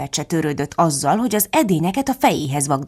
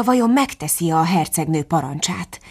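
ordinary delivery

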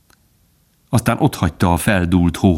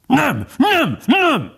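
very high, frantic yelps; raging dog; much slower than usual. A narrator's reading. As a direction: yap the refusals in a rage. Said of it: "tombolt a kutya". (tombolva, éles kutyahangon)

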